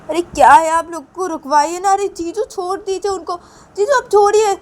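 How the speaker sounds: high, loud pleading brother-in-law; pleading to stop. Acted. Ravi begs the jijaji to let go, high and loud. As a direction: beg them to stop the fight, voice pushed up high.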